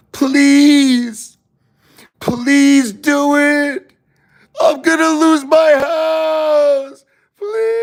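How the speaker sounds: very high, loud, drawn-out wailing plea; wailing beggar. Retold or imitated. To viewers, acting out a beggar on his knees, hammed-up wailing. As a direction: beg on your knees, stretching every please. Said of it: "That's begging to me".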